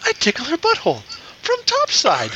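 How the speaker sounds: squeaky crude Jar Jar Binks. Pretend. Jar Jar bit continues.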